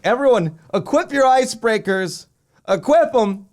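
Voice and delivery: high loud team callout; loud urgent command; calling out. Retold or imitated. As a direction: shout orders to the whole team.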